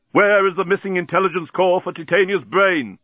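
high-pitched shouted question; yelled demand. A narrator's reading. Bolfas demanding an answer from the parrot, yelling. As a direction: yell the question all the way through. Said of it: "yelled Bolfas".

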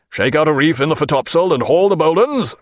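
a captain's order carried across the deck; called order. Acted. Captain calls the sail order to Mister Starton, firmly. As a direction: call the order firmly up to the crew. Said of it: "he called".

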